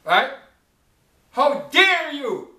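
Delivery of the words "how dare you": "How dare you?" drawn out slow and higher, weight on "dare"; slow rebuke. Acted. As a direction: scold them word by word. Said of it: "preacher rebukes the Hebrews".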